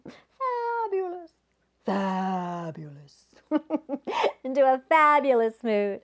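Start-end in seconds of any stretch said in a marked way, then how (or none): 0.0-1.3 "Fabulous" sung high and slow; a high, drawn-out "Fabulous". Shown as a demonstration. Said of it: "Can you say fabulous?". 1.8-3.3 "Fabulous" said low and slow. Shown as a demonstration. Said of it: "Can you say fabulous?".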